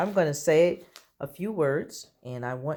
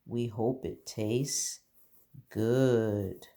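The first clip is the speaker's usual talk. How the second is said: slow modelled sentence; 3 semitones lower, much slower than usual. Shown as a demonstration. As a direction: draw the sentence out gently.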